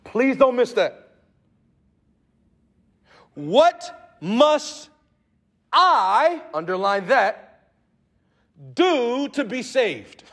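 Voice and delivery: loud, high, driving preacher voice; rising sermon fervour; full-force preaching. Acted. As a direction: preach it hard to the congregation.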